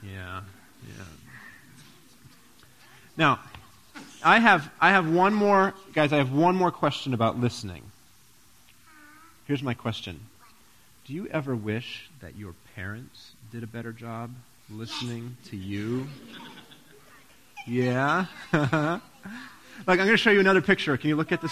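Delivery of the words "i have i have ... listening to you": ordinary delivery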